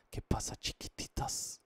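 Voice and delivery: slow breathy ASMR whisper. Pretend. Streamer greets fans in slow ASMR whisper. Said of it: "Voy a hacer una S M R".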